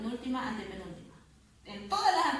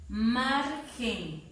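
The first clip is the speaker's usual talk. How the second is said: "margen" with MAR long and strong; two drawn-out syllables, MAR leading in "margen"; stressed syllable demo; 3 dB louder than usual. Shown as a demonstration. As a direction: lean on MAR, let "margen" fall away. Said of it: "dónde está la fuerza de voz".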